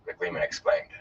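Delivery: usual speaking voice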